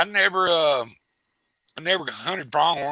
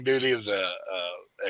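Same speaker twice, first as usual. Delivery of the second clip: plain reading voice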